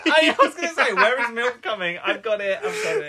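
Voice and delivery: high, loud, delighted realisation; excited. Real, not acted. Co-host suddenly remembers the milk scene, high and delighted. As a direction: burst out thrilled at remembering.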